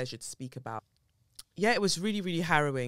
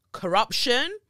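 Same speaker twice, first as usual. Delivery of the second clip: much higher and louder, rising; outraged cry; 10 semitones higher, 10 dB louder than usual. Real, not acted. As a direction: shout it in outrage. Outraged exclaiming.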